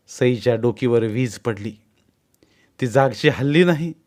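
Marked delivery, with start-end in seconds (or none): none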